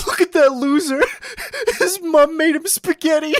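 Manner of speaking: very high, loud laughing jeer; mocking bully. Imitating classmates mocking spaghetti boy, high laughing jeer. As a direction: sneer like a cackling bully. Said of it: "I sat there going".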